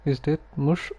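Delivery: ordinary talk, nothing marked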